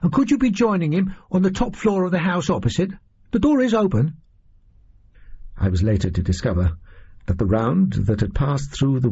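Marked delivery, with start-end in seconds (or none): 0.0-4.1 put-on much higher boy's voice, eager and polite; high eager boy messenger; young page boy. A narrator's reading. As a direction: pitch right up to play the breathless young page. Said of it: "he said".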